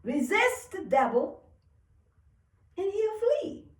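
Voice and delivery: high, loud preacher's quote; scriptural proclamation. Acted. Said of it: "And he said".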